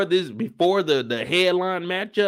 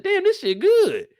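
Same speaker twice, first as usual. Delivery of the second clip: much higher, slow amazed exclaim; hyped exclaim; 4 dB louder, slower than usual. Retold or imitated. Quoting himself watching the show, high amazed exclaim. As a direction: savor it, blown away. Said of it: "I said".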